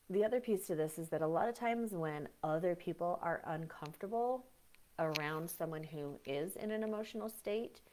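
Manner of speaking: ordinary talk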